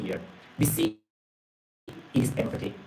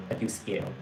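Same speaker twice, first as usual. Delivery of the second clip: softer, scared; 3 semitones lower, 8 dB quieter than usual. Retold or imitated. Yato admitting fear to Mr Sherman on the phone, much softer. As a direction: drop much softer on I feel scared, like a small frightened confession.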